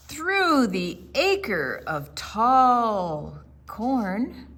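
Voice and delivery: slow, drawn-out wise owl giving directions; unhurried, mysterious directions; slow storytelling owl. Acted. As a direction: lay out the journey slowly like a wise old owl. Barn Owl gives directions.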